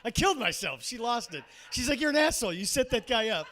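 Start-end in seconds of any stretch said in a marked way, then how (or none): 2.0-3.5 loud, high imitation of an accusing date; mock scolding. Date scolding him for the prank, mock outrage. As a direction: pitch it up and call him out. Acts out his laughing date.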